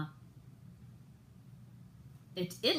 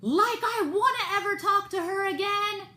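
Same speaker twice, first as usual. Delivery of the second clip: much higher and louder, defiant mock yell; imitated scream; 13 semitones higher, 9 dB louder than usual. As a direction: yell back at the judge like the defendant did. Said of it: "that guy screamed at the judge".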